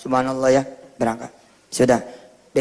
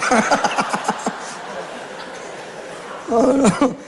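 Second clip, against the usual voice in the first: airy unvoiced laugh; breathy laugh; 7 semitones higher than usual. Real, not acted. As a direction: let out a long breathy laugh. Laugh in the story. (tawa berdesah panjang)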